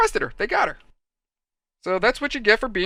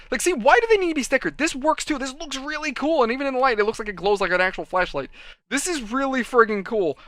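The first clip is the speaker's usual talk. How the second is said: higher-pitched, excited enthusiasm; geeking out; 7 semitones higher than usual. Real, not acted. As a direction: gush like a geek over a cool gadget. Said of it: "I just need to be a geek".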